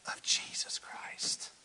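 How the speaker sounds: slow unvoiced whisper; reverent whisper. Real, not acted. Preacher naming Christ to the congregation, slow whisper. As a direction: let the name fall hushed.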